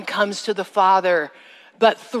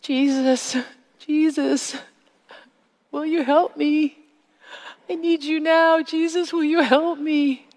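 high, pleading cry for help; desperate plea; 7 semitones higher than usual. Retold or imitated. Girl alone in the orchard calling on Jesus, high and pleading. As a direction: beg as if choking in the dirt. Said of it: "I could hear myself say".

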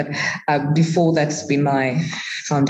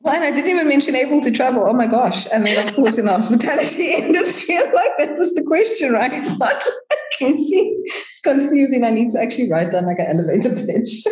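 much higher, fast, bubbling with laughter; giddy laughing chatter; 11 semitones higher than usual. Real, not acted. Guest laughs at forgetting her own travel job, giddy and much higher. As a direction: tumble it out, laughing at yourself.

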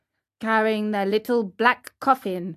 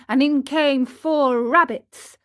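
plain reading voice

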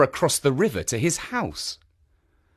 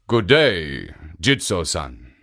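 ogre voice; 8 semitones lower, slower than usual. Acted. Ogre greets statue.

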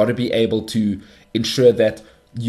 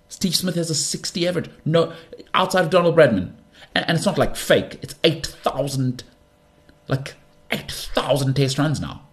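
higher-pitched, exasperated comeback; incredulous rebuttal; 6 semitones higher than usual. Retold or imitated. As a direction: retell an incredulous argument, pitched up.

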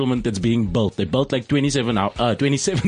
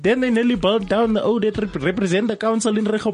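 higher, worked-up local resident voice; a fired-up resident reporting to the radio studio, pitched up; Eric Janssen; 6 semitones higher than usual. Acted. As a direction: play an excited eyewitness from the neighbourhood. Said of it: "Eric Janssen is on the line".

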